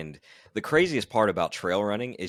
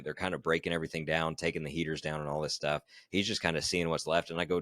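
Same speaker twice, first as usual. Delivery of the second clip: ordinary delivery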